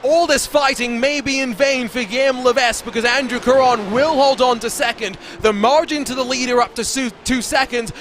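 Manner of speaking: lifted, energetic commentary over the fight for second; race play-by-play. Acted. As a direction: paint the wheel-to-wheel battle for viewers. Second commentator's lap call.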